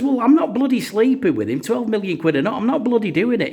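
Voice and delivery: high-pitched, firm refusal as the wife; wife flatly refusing the deal, high and loud; indignant wife. Acted.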